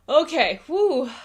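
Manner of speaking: loud high exhale to settle; catching breath. Real, not acted. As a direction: blow it out to calm down. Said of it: "All right, I'm calm".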